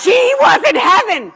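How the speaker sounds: high, loud and slow through laughter; laughing delight. Real, not acted. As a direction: beam it out laughing. Mother loving the fame.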